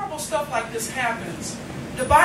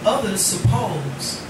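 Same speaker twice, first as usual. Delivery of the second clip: poem recitation; 3 semitones lower, slower than usual. Acted. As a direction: read it tenderly to the room. Recites poem about student's dad.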